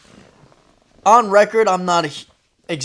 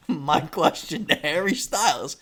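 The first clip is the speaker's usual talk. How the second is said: usual speaking voice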